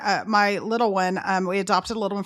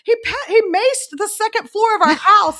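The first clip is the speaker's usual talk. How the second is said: high, loud outraged reenactment; frantic retold yell, pitched way up; yelling mom; 11 semitones higher, 9 dB louder than usual. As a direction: shout the accusation to a husband. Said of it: "what are y'all yelling about".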